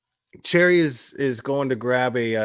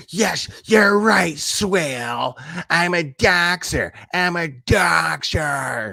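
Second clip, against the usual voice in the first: slow, hammed-up higher mocking voice; mock confession; 5 semitones higher, 4 dB louder, much slower than usual. Streamer imitating the woman who admitted doxing, slow mock voice. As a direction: ham up her whiny admission, drawn out.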